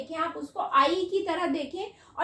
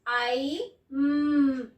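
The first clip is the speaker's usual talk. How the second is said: letter-by-letter spelling; 2 semitones lower, much slower than usual. Shown as a demonstration. Teacher spelling the word slowly for children. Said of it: "word क्या है?".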